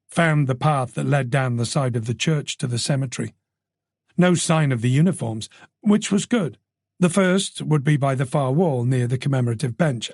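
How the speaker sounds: ordinary delivery, nothing marked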